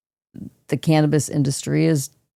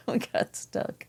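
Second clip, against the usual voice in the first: giggling; 8 semitones higher, 13 dB quieter, slower than usual. Real, not acted. Giggling, stuck while stoned.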